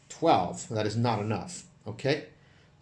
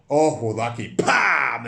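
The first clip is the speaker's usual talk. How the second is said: loud, bright burst of glee; excited cheer; 9 dB louder, much slower than usual. Real, not acted. Game master cheering a lucky kill, excited. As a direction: whoop as the goblin dies. Spell kills goblin, cheering.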